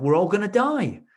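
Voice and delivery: mock panic, high and loud; hammed-up doom cry; panicked. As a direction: act out the panic. Client retelling panicked inner voice.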